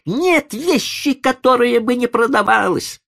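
very high, exasperated insistence; insistent lady. A narrator's reading. Lady pressing the old man to sell, insistent. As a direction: insist in a high-pitched lady's voice. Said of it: "настаивала дама".

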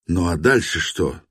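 ordinary delivery, nothing marked

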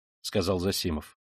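ordinary delivery

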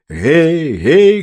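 high, loud "hey, hey"; hailing shout. A narrator's reading. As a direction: hail strangers from across the road. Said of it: "крикнул мужчина".

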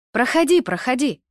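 high-pitched, hurried welcoming call. A narrator's reading. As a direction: call a visitor in while passing by. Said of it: "крикнула мне немолодая женщина".

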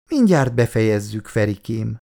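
usual speaking voice

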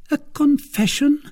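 high, startled woman's voice; high-pitched, surprised query; questioning echo. A narrator's reading. Milady echoes it as a question.

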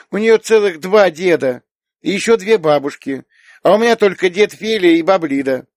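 put-on high, loud child's complaint; small girl tallying what others have; envious little girl. A narrator's reading. Little girl compares grandparents with Yulia's, envious.

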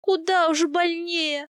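high, slow moaning reply; drawn-out, anguished voice; pained moan. A narrator's reading. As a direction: moan it out through the pain. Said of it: "простонала я".

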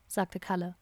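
ordinary delivery

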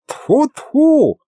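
very high, spooked spitting to ward off bad luck; frightened old sorcerer. A narrator's reading. Old sorcerer fears being overheard, high startled voice. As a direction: spit it out in a high, jumpy voice. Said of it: "испуганно оглянулся старый извращенец".

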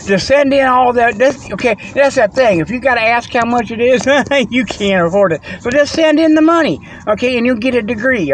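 high, hammed-up huckster pitch, pushing the viewer to just send money; a pushy mail-order ad man, played for laughs; mock sales pitch.

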